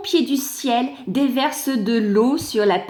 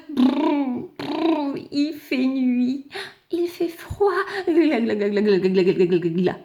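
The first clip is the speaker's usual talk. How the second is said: shivering with cold. Acted. Cold little clod shivering.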